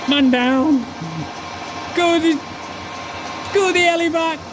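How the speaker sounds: high, loud mock soldier shouting for a chopper; hammed-up call for the medevac chopper; mock army radio call. Mock battlefield call after hand injury.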